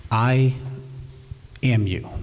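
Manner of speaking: normal talking voice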